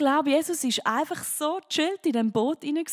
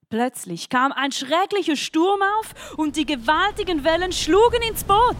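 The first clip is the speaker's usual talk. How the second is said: dramatic Bible reading; 4 semitones higher, slower than usual. Acted. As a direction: storm story read with rising force, slow at first. Reads Bible storm story aloud.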